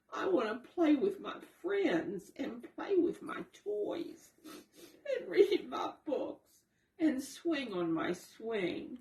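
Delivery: high, whining little voice, slowing as it begs; crying child. A narrator's reading. Raccoon child begging mother to stay home, crying. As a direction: plead like a sobbing little boy. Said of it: "and cried".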